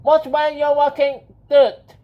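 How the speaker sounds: high, loud little squeak; squeaky voice. A narrator's reading. Tiny mouse scolding a careless walker, squeaky and loud. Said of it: "the squeaky voice said".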